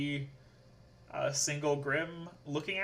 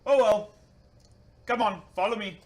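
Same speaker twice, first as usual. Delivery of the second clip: higher, louder bad put-on accent; game master voicing Bruce in a clumsy put-on accent; 6 semitones higher, 9 dB louder than usual. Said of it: "really horrible with accents".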